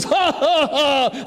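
high, taunting ha ha ha; a gloating devil's laugh; Satan laughing up at heaven; Satan's mocking laugh. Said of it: "he says".

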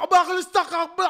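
very loud, high, throaty gibberish; explosive back-of-the-throat tirade; mock Arabic rant. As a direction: imitate a furious dad ranting in Arabic. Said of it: "let's get into the back of the throat".

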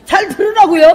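high, loud and defiant; loud retort. Retold or imitated. Wife answering her husband's complaint, high loud retort. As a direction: fire back that it's so he hears. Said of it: "왜 이렇게 소리를 질러".

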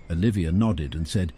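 usual speaking voice

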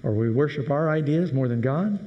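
ordinary delivery

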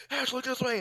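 high call across the field. Retold or imitated. Teammate calling Hatch across the field, high call. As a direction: call high to get his attention. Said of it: "We're both calling Hatch".

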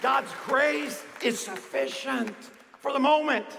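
rousing preacher building with conviction; impassioned preaching. Real, not acted. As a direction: drive it home to the congregation, punching key words. Preaches at full force.

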